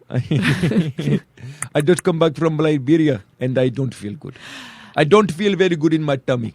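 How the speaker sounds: hammed-up Liberian accent, slowly at first; imitated Liberian accent. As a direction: play a sick patient with a mock Liberian accent. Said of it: "this dude who's Liberian".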